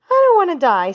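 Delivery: very high, loud scream; a pig shrieking in terror. A narrator's reading. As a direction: Wilbur screams, throwing himself down. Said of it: "screamed Wilbur".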